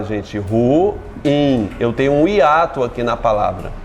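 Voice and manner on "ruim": "ruim" broken into two beats, "ru-im"; slow two-syllable "ruim"; split syllables. Shown as a demonstration. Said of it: "Ruim se separa".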